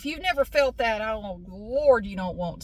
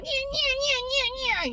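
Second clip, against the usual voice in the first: much higher, dismissive yeah-yeahs; mimicking the dentist; faster than usual. Teller mimicking the dentist brushing her off, high.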